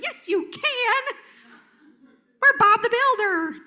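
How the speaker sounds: high, hammed-up pep-talk shout; over-bright cheerleading. Trainer mocking an adult cheering on a defeated kid, hammed-up. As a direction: belt it out with mock gung-ho cheer. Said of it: "kid with the, like".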